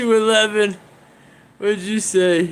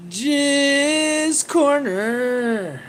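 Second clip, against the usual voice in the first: slow savoring; 4 semitones higher, much slower than usual. Acted.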